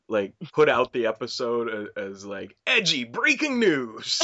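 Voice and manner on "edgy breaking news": slow, high breaking-news announcer; hammed-up newsreader announcement; radio announcer voice. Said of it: "as an insane radio announcer".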